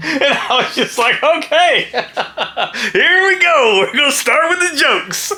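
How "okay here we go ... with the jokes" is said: high laughing okay here we go; amused laughing retort. Retold or imitated. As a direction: laugh through the words. Laughing retold reaction.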